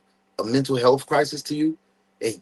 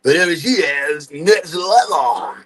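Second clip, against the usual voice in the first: dismissive mocking officer; 3 dB louder, slower than usual. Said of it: "Instead of just like".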